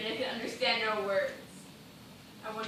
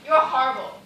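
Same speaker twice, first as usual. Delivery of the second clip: loud, shocked cry; loud horrified rebuke; horrified outburst; 11 dB louder than usual. Acted. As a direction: throw it at her in horror. Recoils from talk of blood.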